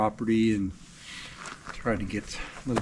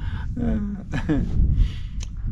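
laugh; 11 semitones higher, 3 dB louder than usual. Real, not acted. Laughing at his frozen mouth.

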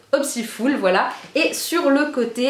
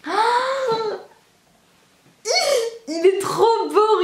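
much higher oh, then slow, smiling gush; delighted; 9 semitones higher, much slower than usual. Real, not acted. Opening the heart blush, a high delighted swoon. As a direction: swoon over the colours.

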